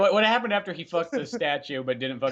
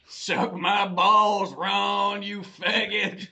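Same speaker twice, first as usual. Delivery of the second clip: very slow, higher Southern drawl; Southern accent; 4 semitones higher, much slower than usual. Acted. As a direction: drag every word out in mock Southern. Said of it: "It's a Southern accent".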